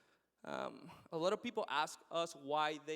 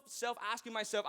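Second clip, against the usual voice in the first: normal talking voice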